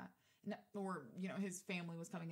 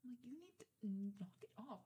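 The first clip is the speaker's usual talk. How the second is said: hushed scolding; 3 semitones higher than usual. Retold or imitated. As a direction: imitate telling someone off under your breath. Scolding date in public.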